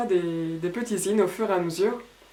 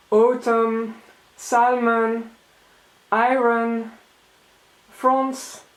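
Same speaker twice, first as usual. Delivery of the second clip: "Autumn, salmon, iron, France" in a French accent; 3 semitones higher, 5 dB louder, much slower than usual. Shown as a demonstration. Showing readers how her French accent sounds in English. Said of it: "This sounds very French to me".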